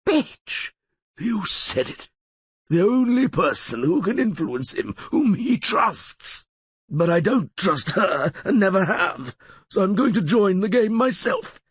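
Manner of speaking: a hint of a sly old schemer; lightly voiced scheming lawyer. Codringer resolves to act.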